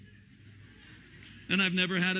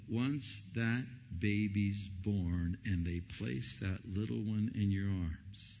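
ordinary talk

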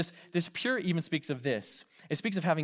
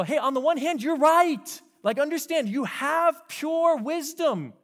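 much higher, slow, soothing wise voice; voicing James; 13 semitones higher, 8 dB louder than usual. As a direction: lift way up and coax like a gentle sage. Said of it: "James is trying to go".

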